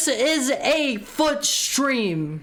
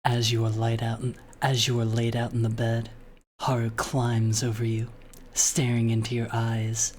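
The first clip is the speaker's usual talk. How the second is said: romantic story reading; 11 semitones lower than usual. Acted. Streamer reads fan fiction to chat in a low romantic narration voice. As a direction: read it like an intimate bedtime romance.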